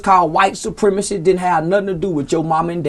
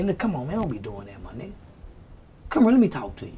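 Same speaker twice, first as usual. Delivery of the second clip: much softer, lower, reassuring; gentle talk; 7 semitones lower, 9 dB quieter, faster than usual. Retold or imitated. A mentor talking softly to a boy after scolding, gentle. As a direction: pull him aside kindly and quietly. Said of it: "talk to him in another way".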